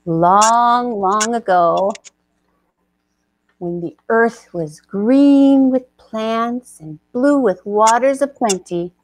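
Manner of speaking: slow, hushed storyteller voice; epic fantasy narrator. A narrator's reading. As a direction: set the scene like an ancient legend. Storyteller sets the scene.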